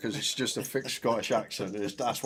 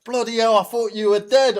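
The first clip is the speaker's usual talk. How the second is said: louder, higher retold shout at the horse; mock yell; 11 semitones higher, 8 dB louder than usual. Owner shouting at her horse that just stood up, mock yell. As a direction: blurt it out like a shocked owner. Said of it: "He goes".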